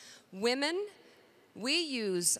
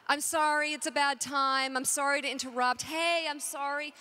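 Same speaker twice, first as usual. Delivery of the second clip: raised, insistent string of apologies; mock over-apologizing; 3 semitones higher than usual. Shown as a demonstration. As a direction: parody someone who keeps saying sorry. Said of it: "If you say you're sorry".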